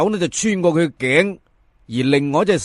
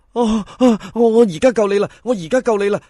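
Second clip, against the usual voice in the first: higher, stuttering in panic; terrified stammer; 5 semitones higher than usual. Acted. Fan stammering a promise to the corpse, terrified. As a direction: blurt it out, scared stiff.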